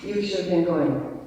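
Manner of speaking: ordinary talk, nothing marked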